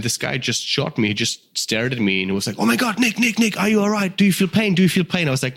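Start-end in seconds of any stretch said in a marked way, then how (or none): 2.6-5.3 high, frantic imitation of the shooter; panicked questioning. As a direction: mimic a worried friend checking on him.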